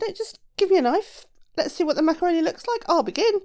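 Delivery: high, loud, eager character voice; confident shoemaker. A narrator's reading. Shoemaker asking the giant for a knife, loudly confident.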